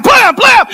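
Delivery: high shouted slamming noises; explosive comic sound effect; mock door slam. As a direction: imitate slams at full volume.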